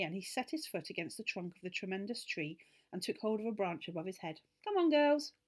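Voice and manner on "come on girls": put-on eager boy. Boy urges girls to climb.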